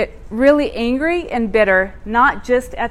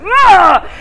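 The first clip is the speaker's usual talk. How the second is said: loud, high tantrum groan; frustrated groan; 9 semitones higher, 9 dB louder than usual. Retold or imitated. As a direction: let out a loud angry ugh. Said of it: "I was like".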